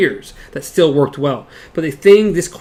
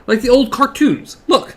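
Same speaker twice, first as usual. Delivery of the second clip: quick, impatient and raised; 8 semitones higher than usual. A narrator's reading. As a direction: push it out like an impatient kid. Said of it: "the boy said impatiently".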